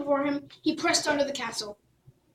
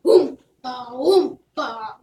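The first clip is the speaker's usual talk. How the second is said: wheezing concertina; 7 dB louder, much slower than usual. Acted. The concertina starting the jig, slow loud oompa. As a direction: squeeze it out like an accordion. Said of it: "wheezed the instrument".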